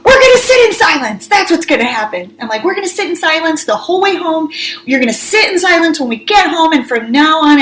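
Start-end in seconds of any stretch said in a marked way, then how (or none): 0.0-2.3 high, indignant scolding; re-enacted furious mom decree; retold yelling. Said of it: "I'm, like, yelling at them". 2.6-7.6 higher, emphatic scolding; re-enacted exasperated mom rant; retold angry yelling. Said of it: "I'm, like, yelling at them".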